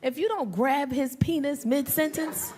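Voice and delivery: ordinary delivery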